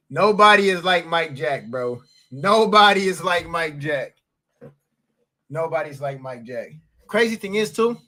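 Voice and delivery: ordinary talk, nothing marked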